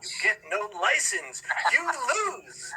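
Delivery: a gloating mock examiner. Mocking the driving examiner.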